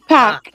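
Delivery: "Park" as "pahk", no R; "Park" said "pahk", R dropped; Boston park. Shown as a demonstration. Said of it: "keep practicing park".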